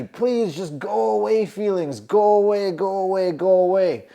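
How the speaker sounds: begging the feelings to go; pleading with feelings. Retold or imitated. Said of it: "you can feel the energy".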